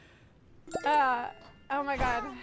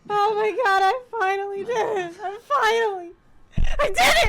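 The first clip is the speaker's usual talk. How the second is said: choked-up shout of joy; almost crying with relief; overjoyed, near tears; 7 semitones higher, 9 dB louder than usual. Real, not acted. Overwhelmed after the win.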